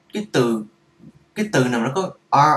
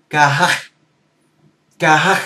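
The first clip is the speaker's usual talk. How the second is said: "ca hát" in an Arabic accent, the h rasped hard and loud from the throat; 6 dB louder than usual. Shown as a demonstration. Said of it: "sử dụng cái tiếng Ả Rập". ("ca hát" giọng Ả Rập, chữ h bật mạnh từ cổ họng)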